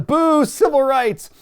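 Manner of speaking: loud, high and slow mocking boo; mock crowd boo. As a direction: jeer it like a rowdy crowd. Mimicking crowd booing civil rights.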